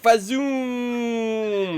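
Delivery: high swooping "Fazoom" falling off; whooshing sound effect. Acted. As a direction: make the "Fazoom" fly past then drop. Mimicking scenery rushing by.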